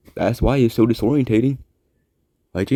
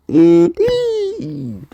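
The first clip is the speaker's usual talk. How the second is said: high, triumphant squeak; much higher victorious cry; triumphant exclaim. Real, not acted. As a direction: crow it with pride. Triumphant exclamation about episodes.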